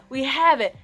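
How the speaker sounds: excited salesman. Retold or imitated. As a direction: say it again, still thrilled. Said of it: "He's so excited".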